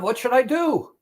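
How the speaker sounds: high-pitched, acted exasperated question; helpless appeal. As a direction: ask as if worn down by nagging. Acted plea of tempted patient.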